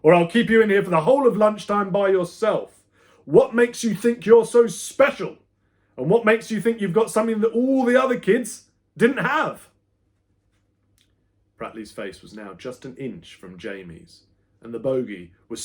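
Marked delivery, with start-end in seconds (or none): 0.0-9.7 high, loud threatening rant; yelling teacher. A narrator's reading. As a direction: threaten and scorn at full volume. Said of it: "yelled Pratley".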